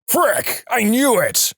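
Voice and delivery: high-pitched pretend dismay; hammed-up high yelp at normal volume; mock outburst. Imagined outraged outburst before storming out.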